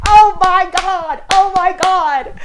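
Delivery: real delight; excited gush. Real, not acted. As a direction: gasp it out like your favorite book just appeared. Thrilled at the next book.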